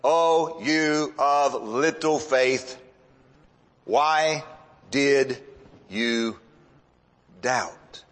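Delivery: very slow, low reproof, word by word; Jesus reproving. Retold or imitated. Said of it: "and said to him".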